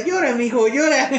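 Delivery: high, coaxing llora mijo; kindly older lady. Retold or imitated. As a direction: urge the boy to let it out. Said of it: "Y la señora".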